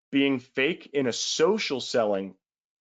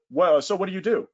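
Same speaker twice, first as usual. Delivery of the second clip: higher, louder curious question; chatting up the acquaintance, eager; eager small talk; 7 semitones higher, 4 dB louder than usual. Pretend. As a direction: ask with put-on interest. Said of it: "you go".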